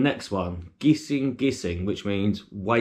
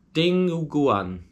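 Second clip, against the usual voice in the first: high, drawn-out word in a put-on Filipino accent; attempted Filipino accent; 6 semitones higher, slower than usual. Shown as a demonstration. British vlogger trying to pronounce a dish name, mock Filipino accent. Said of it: "do it in a Filipino accent".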